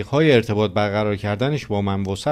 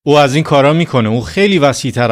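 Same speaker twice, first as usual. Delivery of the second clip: loud, fast, insistent declaration; preacher insisting to doubters, loud and fast; emphatic preaching; 3 semitones higher, 6 dB louder, faster than usual. Real, not acted. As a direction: hammer it home to the congregation.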